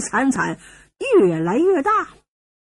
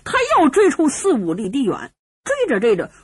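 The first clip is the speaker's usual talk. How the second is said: ordinary delivery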